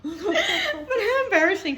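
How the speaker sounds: high, drawn-out laughing words; laughing embarrassment. Real, not acted. Recalling falling asleep in the salon, laughing. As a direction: dissolve into laughter over the embarrassment.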